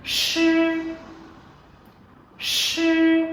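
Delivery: "Sư" with the tongue curled back and a long hiss; model syllable. Shown as a demonstration. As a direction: demonstrate "Sư" twice for repetition. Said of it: "Các bạn cùng đọc lại theo cô nhé". (đọc mẫu "Sư" uốn lưỡi)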